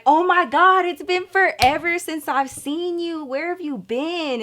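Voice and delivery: high-pitched, gushing mock reunion; excited greeting. Date greeting an old friend he hugs, imitated excited gushing. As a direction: imitate a thrilled hello to a long-lost friend.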